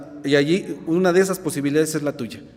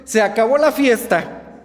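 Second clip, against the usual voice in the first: con autoridad; 6 semitones higher, slower than usual. Acted. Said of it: "con autoridad".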